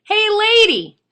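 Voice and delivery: very loud, high parrot holler; piercingly high, full-volume bird call. Acted. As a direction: holler it across the street like a parrot. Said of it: "and even louder, he calls out".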